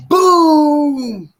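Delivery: high, loud shout of boom. Real, not acted. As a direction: belt out the boom like a kick landing. Shouted kick climax.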